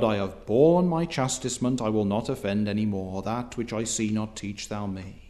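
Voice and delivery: soft, quiet, submissive prayer to God; humble godly prayer. Acted. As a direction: pray it meekly, fading to near silence. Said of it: "in a godly fashion".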